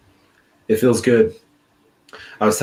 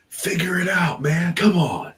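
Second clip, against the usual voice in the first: higher pitched impatient yell, imitating the trainers; mock angry instructor; 10 semitones higher than usual. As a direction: bark at him to figure it out.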